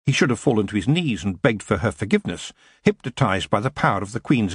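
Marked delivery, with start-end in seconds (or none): none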